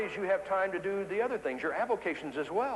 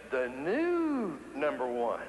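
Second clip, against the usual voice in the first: "new" stretched very long, then "number one"; drawn-out stress; much slower than usual. Retold or imitated. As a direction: hold the word "new" for effect before finishing. High performers' punchline, stretched word.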